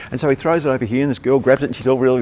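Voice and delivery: usual speaking voice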